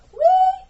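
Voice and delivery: high loud bird "wee"; chirp. A narrator's reading. Ava answering the bird, high chirp. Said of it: "Ava chirped back".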